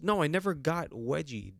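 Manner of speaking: ordinary talk, nothing marked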